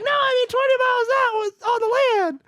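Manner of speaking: put-on very high, squeaky exasperated kid; squeaky kid correction. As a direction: squeak it out like a frustrated little boy.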